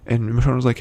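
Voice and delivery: ordinary talk, nothing marked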